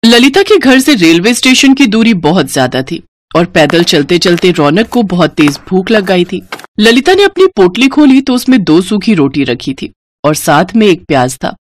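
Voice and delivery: plain reading voice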